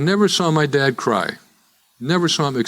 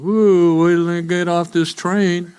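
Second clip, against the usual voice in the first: mocking onlookers; 6 semitones higher, slower than usual. Passengers laughing about the man talking to Satan. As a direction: hoot at the crazy man on the train. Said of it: "imagine the people sitting around me going".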